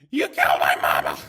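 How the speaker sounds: deep, very loud demonic scream; doll scream. A narrator's reading. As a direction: roar the accusation like a monster. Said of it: "the doll screamed".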